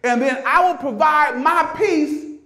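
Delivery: high, shouted sermon climax, words stretched out; full-force preaching. Acted. Preacher voicing Jesus's promise to the congregation, full-force shouted preaching.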